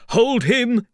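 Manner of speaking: high, loud shouts of alarm; urgent shouting from a crowd; excited cries. A narrator's reading. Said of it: "There were excited cries of".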